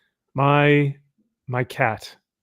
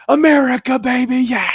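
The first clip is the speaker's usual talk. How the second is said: high, loud whooping cheer; drunk party yell; 12 semitones higher, 7 dB louder than usual. Retold or imitated. Drunk guy whooping at other boaters, loud cheer. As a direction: yell it like a drunk reveler on a boat. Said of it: "we'd hop onto other boats and be like".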